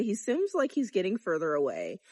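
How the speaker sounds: normal talking voice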